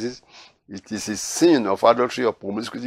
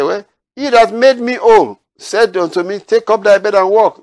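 indignant defence; 9 semitones higher, 8 dB louder than usual. Retold or imitated. Healed man defends himself.

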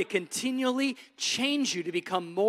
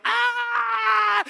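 high shrieking yelp of panic; mock terrified scream; 14 semitones higher, 8 dB louder than usual. Preacher mocking believers fleeing the enemy, shrill scream. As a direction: shriek like a scared kid running.

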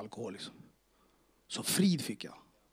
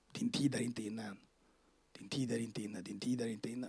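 low, soft, repeating voice; voice in the head; 4 semitones lower, 9 dB quieter than usual. Retold or imitated. A voice repeats itself inside a troubled man's head, low and hushed. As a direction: intone it lower and quieter, like a haunting inner voice. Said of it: "jag hör bara en röst".

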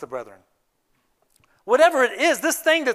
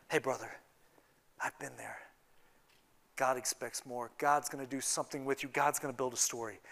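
low, hushed, tender reassurance; gentle consoling friend; 9 semitones lower, 15 dB quieter than usual. Acted. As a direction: speak softly, like comforting a fallen brother. Voices a consoling friend alongside the fallen.

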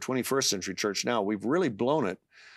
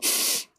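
sharp imitated sniff. Retelling how a friend began to cry, imitated sniff. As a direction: mimic a tearful sniffle. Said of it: "then I hear him go".

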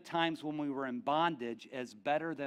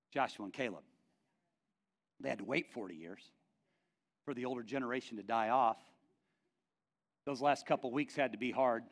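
ordinary delivery

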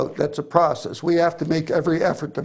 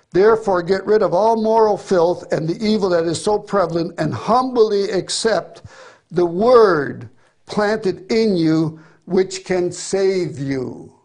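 solemn scripture reading; 3 semitones higher, 4 dB louder than usual. Acted. Preacher reading James aloud to the church, solemn and slow. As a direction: proclaim the verse slowly, word by word.

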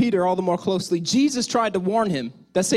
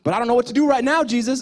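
mild panic; 6 semitones higher, 4 dB louder, faster than usual. Acted. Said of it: "Peter was freaking out".

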